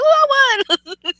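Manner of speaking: high-pitched delighted outburst, retold; excited. Fantasy player retelling his narrow win, excited. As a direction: relive the win with a high little cheer. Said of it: "I was, I was excited".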